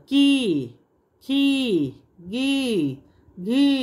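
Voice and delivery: की खी गी घी, vowel pulled long and slow; long sound. Shown as a demonstration. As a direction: stretch out each ई in की, खी, गी, घी slowly. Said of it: "थोड़ा खींच कर हम इसे उच्चारण करेंगे".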